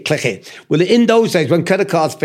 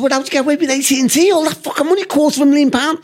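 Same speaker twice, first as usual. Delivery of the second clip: incredulous question; 10 semitones higher, faster than usual. Retold or imitated. Retelling his own incredulous question.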